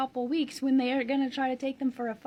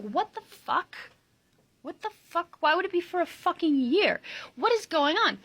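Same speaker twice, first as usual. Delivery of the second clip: outraged; 7 semitones higher, 4 dB louder than usual. Retold or imitated. Outraged retold reaction.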